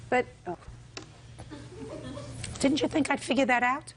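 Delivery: mock disbelief, scolding; sarcastic scolding tv judge. Real, not acted. Judge catching an inflated claim.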